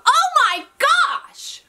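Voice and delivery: very high, slow shocked cry; stunned realisation. Real, not acted. Realising she said it wrong.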